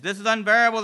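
loud anguished cry; man in the heat of hell crying out, loud. Acted. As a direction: cry out in pain. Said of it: "the man cries".

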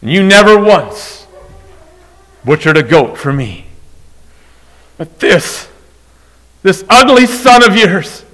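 loud, high indignant complaint; resentful older brother. Acted. Preacher voicing the older brother's grievance to the father: loud, high, indignant. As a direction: rant like the wronged older brother.

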